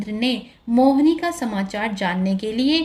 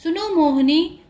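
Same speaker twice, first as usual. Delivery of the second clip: high, insistent repeated call; raised voice, hailing her; 7 semitones higher than usual. A narrator's reading. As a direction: call after someone walking away. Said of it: "उसको पुकारते हुए बोला".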